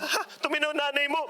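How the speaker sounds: high, amused "Ha?" of disbelief; laughing in disbelief at the message. Retold or imitated. Surprised speaker reacting to the woman's message, high and amused. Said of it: "tawang-tawa ko talaga".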